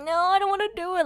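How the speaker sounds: put-on high, fast childish whine; whiny scared kid. A girl mocking her fussy younger self at the dentist, high, fast whine. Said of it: "I would be like".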